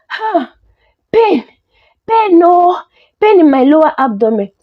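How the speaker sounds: high, hammed-up cries of pain; faked pain cry. A narrator's reading. Wife faking belly pain to mother-in-law, mock agonised cry. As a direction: cry out high in mock agony, short bursts. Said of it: "she screamed, faking a pain in her abdomen".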